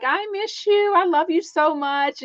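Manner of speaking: gushing fangirl. Retold or imitated. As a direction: squeal it out like a starstruck fan. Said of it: "Andy wanting to fangirl out".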